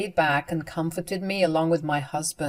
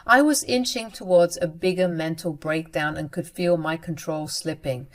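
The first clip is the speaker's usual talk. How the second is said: ordinary delivery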